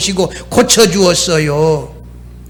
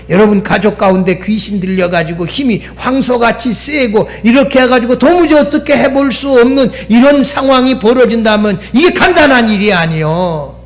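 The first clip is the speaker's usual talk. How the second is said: ordinary talk, nothing marked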